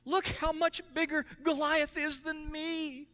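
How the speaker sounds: high, slow, whiny soldier voice; mock the timid soldiers; fearful soldier. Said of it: "the other soldiers were saying".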